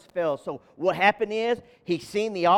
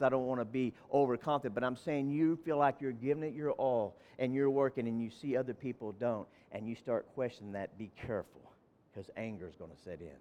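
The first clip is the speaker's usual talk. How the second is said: much softer, lower and fading; dropping to a hush; 8 semitones lower, 12 dB quieter than usual. Acted. Pastor confiding to tired church workers, fading soft. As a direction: draw them in close. Intimate low confession.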